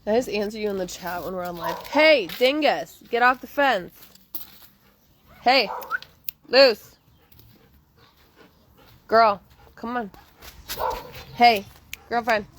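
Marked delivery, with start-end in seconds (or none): 1.9-5.7 loud scolding call; yelling at dogs. Real, not acted. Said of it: "I'm gonna go yell at the dogs". 6.5-12.5 loud call across the yard; calling the dog. Real, not acted. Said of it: "I'm gonna go yell at the dogs".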